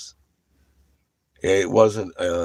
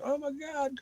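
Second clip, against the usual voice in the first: squeaky, high, panicked gasp of words; hammed-up fright on the cliff edge; a mock-scared high squeal; mock terrified; 6 dB quieter than usual. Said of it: "My little asshole's puckered up on the seat going".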